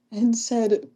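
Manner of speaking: normal talking voice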